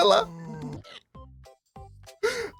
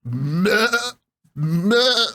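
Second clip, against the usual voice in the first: poor goat-like bleat; half-hearted sheep's bleat, short; badly faked sheep; 3 semitones higher than usual. Player recording a sheep sound, badly faked bleat. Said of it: "that's the worst sheep sound".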